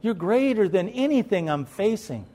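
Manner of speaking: a lightly voiced, earnest prayer to the Father; sample prayer. Shown as a demonstration. Preacher showing the church how to pray, lightly voiced as a prayer. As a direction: model a simple heartfelt prayer, slightly hushed. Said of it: "Tell God".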